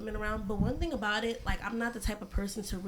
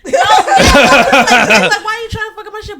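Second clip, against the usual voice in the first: laughing; 11 semitones higher, 20 dB louder than usual. Real, not acted. Repeats with big laugh.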